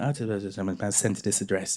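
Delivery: imitating a low-voiced Essex mum. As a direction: read it half-aloud as mum, low and quiet. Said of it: "She went".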